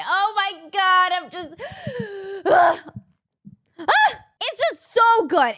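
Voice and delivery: holding back real tears and failing; fighting back tears. Real, not acted. Overwhelmed streamer choking up while watching the talk, tearfully. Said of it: "I'm trying so hard not to cry".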